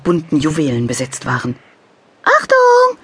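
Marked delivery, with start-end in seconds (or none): 2.2-3.0 high, loud angel cry; shouted warning. A narrator's reading. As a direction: shout the warning before landing. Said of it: "schrie Bömmelchen".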